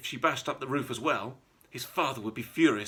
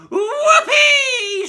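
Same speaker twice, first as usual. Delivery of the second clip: put-on high, loud screeching old woman's whoop; crazed old witch shrieking with joy; Grandma's whoop; 14 dB louder than usual. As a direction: let out a wild cackling whoop. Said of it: "she shouted".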